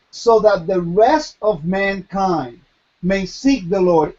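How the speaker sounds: normal talking voice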